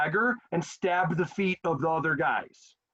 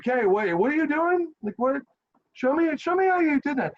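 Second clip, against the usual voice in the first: gawking soldiers begging to learn the trick, pitched way up; amazed soldiers; 9 semitones higher, 4 dB louder than usual. Retold or imitated. Soldiers amazed by the notched shield, begging to see it, high astonished voice. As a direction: voice jumps much higher and louder in astonished disbelief. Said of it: "And they're like".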